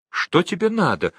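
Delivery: high, pleading question. A narrator's reading. Gao asking Chumboka what he wants, pleading. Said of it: "умоляюще спросил Гао у Чумбоки".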